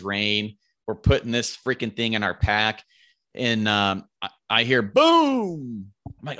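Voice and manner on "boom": drawn-out "boom"; imitated gunshot boom. Hunter imitates his friend's rifle shot, booming.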